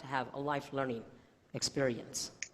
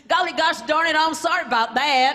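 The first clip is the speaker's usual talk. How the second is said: louder, higher hammed-up Texan twang; mock Texan; 9 semitones higher, 9 dB louder than usual. Answering the gentleman, mock Texan twang. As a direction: lay the folksy accent on thick. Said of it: "I responded with".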